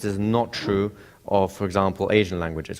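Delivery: ordinary talk, nothing marked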